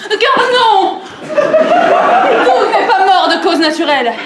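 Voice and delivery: loud, slow, theatrical announcement; dramatic declaration. Acted. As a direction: proclaim it to the whole room. Dramatic reveal to the room.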